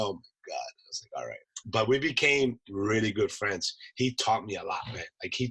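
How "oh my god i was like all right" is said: low, very soft aside to oneself; subdued resignation. Retold or imitated. Scolded Marine giving in to his sergeant major, quietly subdued. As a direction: give in under your breath.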